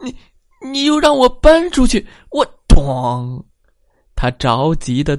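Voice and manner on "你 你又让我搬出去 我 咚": higher, louder, fast anxious protest; 胆小先生着急; flustered outburst. A narrator's reading. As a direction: sputter it out in a panic. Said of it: "胆小先生着急了".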